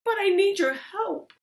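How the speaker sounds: Lily begging the goblin for help; pleading Lily. Acted. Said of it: "she said".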